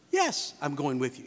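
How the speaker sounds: low, assured reply; matter-of-fact acted yes; affirmative answer. Shown as a demonstration. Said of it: "you expect a yes answer".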